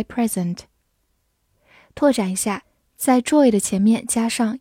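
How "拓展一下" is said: ordinary talk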